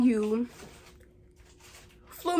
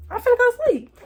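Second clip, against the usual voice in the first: sleepy mock voice; 10 semitones higher, 4 dB louder than usual. Pretending to be asleep.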